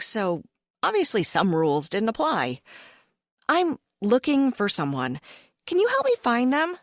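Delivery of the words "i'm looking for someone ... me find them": eager question. Acted. Narrator's own character asks.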